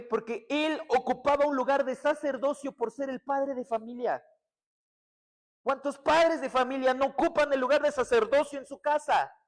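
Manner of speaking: loud, high-pitched fervent preaching; preaching at full force. Acted. Preacher exhorting the congregation on family prayer, fervent loud preaching. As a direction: drive it home to the whole church.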